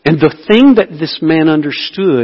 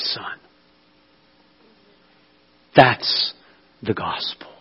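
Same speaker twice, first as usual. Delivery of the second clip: hushed whisper. Acted. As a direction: drop to an awed whisper. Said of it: "hushed whisper of the gospel".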